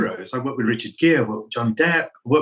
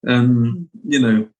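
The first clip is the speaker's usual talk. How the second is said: ordinary delivery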